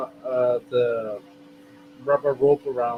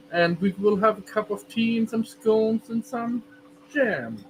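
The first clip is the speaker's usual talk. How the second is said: a hammed-up bad British accent, pitched higher at first; 6 semitones higher than usual. Offering the chat tea and scones in a mock British accent. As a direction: playfully put on a poor posh British accent. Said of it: "Of course I will talk with a British accent".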